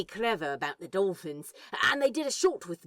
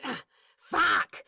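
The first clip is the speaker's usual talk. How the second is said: sudden pained outburst, pitched up; pained yelp; 7 semitones higher than usual. Acted. Crowley kicked in the shins by Bees, yelping in pain. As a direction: yelp as the kick lands on your shin.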